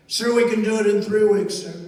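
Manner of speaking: loud crisp soldier's reply; loud military answer. Retold or imitated. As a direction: bark it out like a general reporting. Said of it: "say it one more time".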